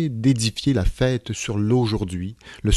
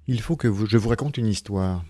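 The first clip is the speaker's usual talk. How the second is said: usual speaking voice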